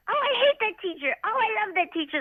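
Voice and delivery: high mock little girl whine; young daughter voice. Mother voices her daughter again.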